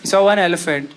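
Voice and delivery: ordinary delivery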